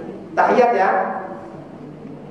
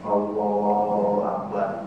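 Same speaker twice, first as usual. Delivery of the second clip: quiet slow takbir; 3 semitones lower, 9 dB quieter, much slower than usual. Shown as a demonstration. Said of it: "larang kagak ada bulunya".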